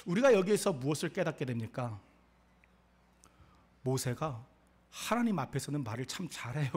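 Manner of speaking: ordinary delivery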